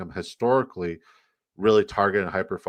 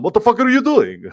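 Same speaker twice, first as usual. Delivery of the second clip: very high, hammed-up Middle Eastern accent shout; over-the-top Middle Eastern accent, loud and shrieky; 11 dB louder than usual. Shown as a demonstration. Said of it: "supposed to be my Middle Eastern accent".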